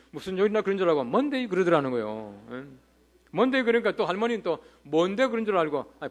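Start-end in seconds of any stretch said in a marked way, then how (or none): 1.0-1.5 mock American. American mishears, answers.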